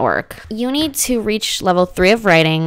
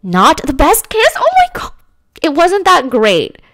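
high, loud incredulous outburst; indignant disbelief; 9 semitones higher, 6 dB louder than usual. Real, not acted. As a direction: react loudly, pitched way up, scandalized. Outraged at game's bad kiss verdict.

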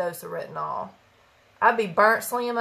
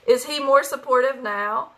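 usual speaking voice

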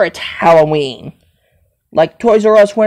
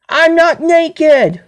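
higher, drawn-out indignant denial; indignant protest; 7 semitones higher, slower than usual. Acted. Denying being naked in a squabble, indignant. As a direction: protest it slowly, pitch raised.

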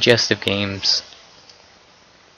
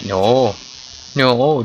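ordinary talk, nothing marked

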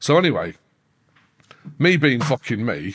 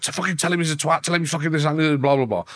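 quick, higher mock rant; Charlie's message; 5 semitones higher, faster than usual. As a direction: rattle off Charlie's insults fast.

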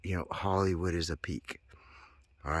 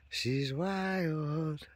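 rising sing-song; 8 semitones higher than usual. Shown as a demonstration.

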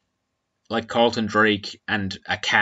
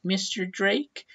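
high, soft little question; high-pitched mock schoolgirl query; girl asking a question; 11 semitones higher, 5 dB quieter, slower than usual. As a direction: imitate a timid girl piping up, much higher pitched. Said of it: "some girl puts her hand up".

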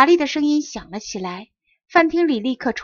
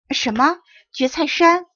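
higher, louder, shocked; stunned disbelief; 5 semitones higher, 4 dB louder than usual. A narrator's reading. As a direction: blurt it out in astonishment. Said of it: "我惊呆了". (惊呆地高声反问)

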